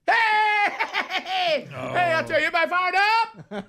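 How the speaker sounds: high, whooping shout; rowdy entrance. Retold or imitated. Late speaker walks into the event, high rowdy shouting. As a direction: burst in hollering to fire up the room. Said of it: "you know how I'm gonna come in".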